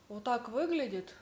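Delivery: ordinary talk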